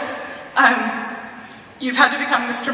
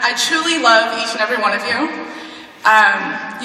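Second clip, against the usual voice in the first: usual speaking voice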